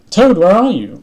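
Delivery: loud, high searching call. A narrator's reading. Frog looking for Toad in the house, calling. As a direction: holler for a friend you can't find. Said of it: "called Frog".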